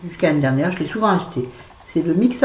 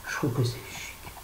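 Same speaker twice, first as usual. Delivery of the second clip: whispered; 12 dB quieter than usual. Real, not acted. An aside about red polish.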